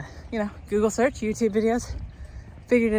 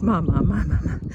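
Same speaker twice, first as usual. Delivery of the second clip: louder wailing "mama, mama, mama"; crying baby imitation; 5 dB louder than usual. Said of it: "he starts crying the same thing of".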